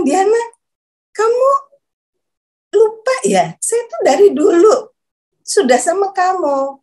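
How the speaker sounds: lightly acted voice of God reminding her; God's voice. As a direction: retell a divine voice, pitched well up. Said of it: "suara itu menjawab".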